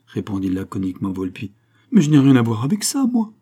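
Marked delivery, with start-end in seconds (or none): 1.9-3.3 protesting suspect. Acted. Valentin denies involvement.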